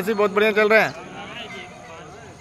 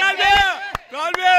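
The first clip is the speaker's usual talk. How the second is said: cheering on; 7 semitones higher than usual. Real, not acted. Cheering a runner in race.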